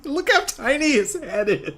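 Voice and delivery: amused high squeak. Real, not acted. Mocking Chris Redfield's tiny head.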